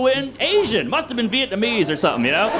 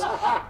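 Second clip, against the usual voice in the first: long hoarse-throated imitated yell; drawn-out battle yell, acted out; mock war cry; 5 dB quieter than usual. Enraged man bursts out of his door, mock war cry. Just goes.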